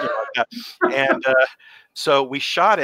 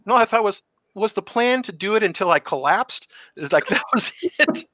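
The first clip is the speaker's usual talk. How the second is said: incredulous; 9 semitones higher, 4 dB louder than usual. Real, not acted. Incredulous laughing aside.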